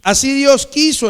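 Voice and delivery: ringing out the refrain; emphatic proclamation. Real, not acted. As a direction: proclaim it forcefully to the congregation. Preacher proclaims his refrain after pause.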